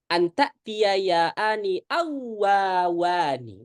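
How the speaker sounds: awawani drawn out very slowly, each waw separate; clear recitation. Shown as a demonstration. Teacher reciting the rule text for students, slow and clear. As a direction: recite awawani slowly so both waw sounds are distinct. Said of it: "harus jelas".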